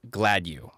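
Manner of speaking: plain English "glad you", slowly; own name pronunciation. Shown as a demonstration. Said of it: "I pronounce it".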